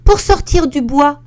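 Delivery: very high, loud little bear calling; squeaky helpful shout; tiny voice call. Acted. Little bear shows her the way home, high loud call. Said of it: "lui crie de sa toute petite voix".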